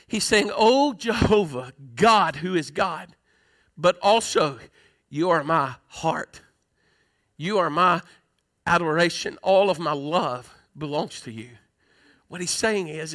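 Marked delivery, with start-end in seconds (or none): 0.5-11.5 tender endearment. Acted. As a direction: sink gently into intimate prayer, pausing between phrases. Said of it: "Adonai is a term of endearment".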